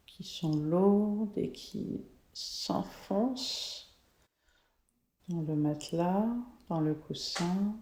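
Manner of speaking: slow, soft meditation voice; guided meditation. Acted. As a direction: guide them into calm, slowly. Leads a guided color meditation.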